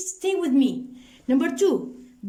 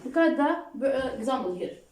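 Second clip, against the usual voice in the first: usual speaking voice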